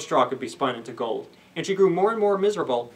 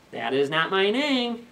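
teasing little man; 4 semitones higher, slower than usual. Acted. Little man refuses name.

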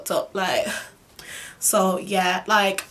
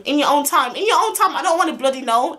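higher, exasperated mocking repeat; rising indignation, pitched up; indignant echo; 5 semitones higher, 4 dB louder than usual. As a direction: throw his words back at him, outraged. Acted angry echo of his excuse.

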